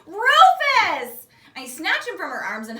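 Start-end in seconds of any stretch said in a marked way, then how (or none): 0.0-1.3 high, loud shout of the name; joyful cry. A narrator's reading. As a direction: cry out in pure delight. Reunited with toy elephant.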